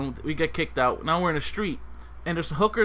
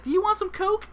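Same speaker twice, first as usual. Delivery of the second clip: much higher pushy street hustler; hooker voice. Retold or imitated. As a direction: pitch it up like a dealer offering.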